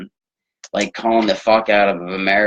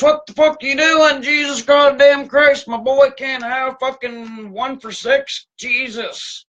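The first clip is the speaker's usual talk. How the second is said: mock outraged sports dad, much higher and frantic; LeVar Ball freakout. Mocking a dad losing it at his son's coach, high hammed-up rant. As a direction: ham up a furious dad screaming at the coach in a squeaky high voice. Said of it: "LeVar to come down and start freaking the fuck out on the coach".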